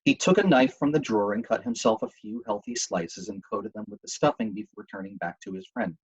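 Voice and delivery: ordinary delivery, nothing marked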